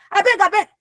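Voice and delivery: high-pitched mock arguing; acted arguing. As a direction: raise the pitch as in a mock quarrel. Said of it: "We cannot be arguing and I'm like".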